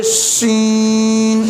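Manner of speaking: शीन held long and firm; lengthened letter. Shown as a demonstration. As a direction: draw out शीन firmly. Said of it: "सख्त करना है और लंबा करके पढ़ना है".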